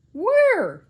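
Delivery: loud, high one-word yell. A narrator's reading. Angry museum man demanding the baby, loud yell. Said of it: "yelled Mister Frizzle".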